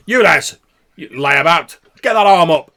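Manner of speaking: barking orders across the yard; bellowing drill sergeant. Acted. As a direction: yell it at lazy recruits. Drill instructor yelling at recruits.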